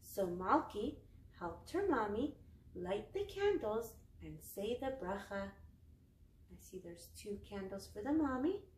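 usual speaking voice

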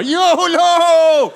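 high, loud drawn-out shout; high, loud wild partier yelling; mock yolo yell.

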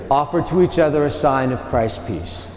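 usual speaking voice

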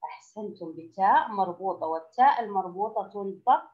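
ordinary talk

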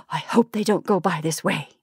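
usual speaking voice

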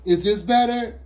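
loud. Acted. Ruby testing her volume.

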